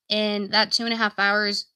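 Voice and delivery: normal talking voice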